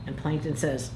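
usual speaking voice

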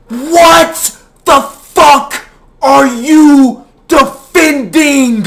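high, loud, slow and cracking; nearly crying out in exasperation; anguished yell. Real, not acted. Rant peaks, voice cracks.

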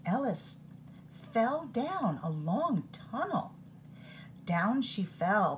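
ordinary delivery, nothing marked

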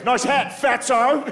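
high sneering taunt; mocking goth. Comic imitating the goth mocking his hat, pitched high. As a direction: toss off the insult in passing. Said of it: "He goes".

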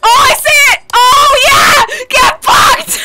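high, loud crowing breaking into laughter; gleeful shout. Real, not acted. Player gloating as the ghost hunts their friends, gleeful shouting. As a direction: crow in triumph.

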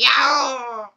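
loud shouted cartoon yelp, a rough imitation; Waluigi's cry. Imitates the character's yell for viewers, loud shout. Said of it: "He's like".

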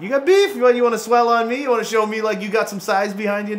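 high-pitched trash talk; mock tough-guy taunt. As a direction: taunt them like you're picking a fight. Taunts an opponent after a hit.

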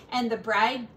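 ordinary delivery, nothing marked

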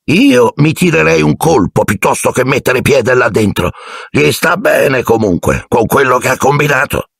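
slow-voiced driver. A narrator's reading. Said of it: "con la sua voce lenta".

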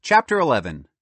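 ordinary delivery

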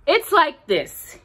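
Pooh starting his big explanation. Acted.

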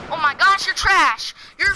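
high, loud gleeful taunt; taunting shout. Real, not acted. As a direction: yell it at the beaten enemy. Taunting an opponent in game.

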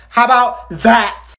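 slow, high, loud gloating; teacher gloating over a test; smug taunt. Acted. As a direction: rub it in. Teacher threatens homework.

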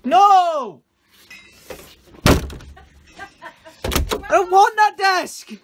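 loud, high, slow yell; tantrum shout. Pretend. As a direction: stamp it out like a toddler. Mock tantrum.

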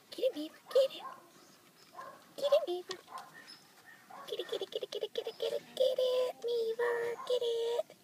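high excited urging of a dog; yelling from the sidelines. Real, not acted.

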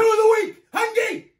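high, loud announcer shout; hype shout. Acted. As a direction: belt out the segment title. Hyping the segment before clip.

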